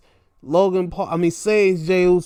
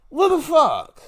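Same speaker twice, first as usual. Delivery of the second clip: exasperated disbelief; 9 semitones higher than usual. Real, not acted. Baffled by the booking.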